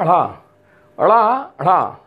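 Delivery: ड़ा and ढ़ा with the tongue flicking down hard; demonstrating flapped consonants. Shown as a demonstration. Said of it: "इसका उच्चारण होता है ड़ा".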